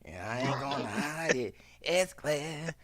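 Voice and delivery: soft, strained monster voice imitation; monster-like woman. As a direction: mimic a woman with a wrecked voice. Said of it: "she like a straight monster".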